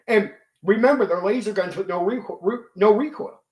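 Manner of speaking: ordinary delivery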